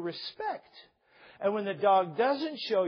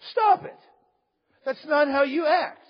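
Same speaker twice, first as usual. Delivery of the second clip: higher, louder parental telling-off; firm parent; 6 semitones higher, 6 dB louder than usual. Acted. Parent tells a child how to act, firm and loud. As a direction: correct a child firmly, in separated phrases. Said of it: "A parent says".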